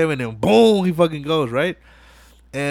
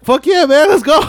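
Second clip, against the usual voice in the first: hyped cheer; 8 dB louder than usual. Retold or imitated. Toretto cheering escape.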